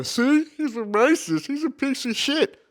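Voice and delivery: imagined internet critics calling him out in a high mock voice. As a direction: sneer it out like a high-pitched accuser digging up old clips. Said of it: "and they go".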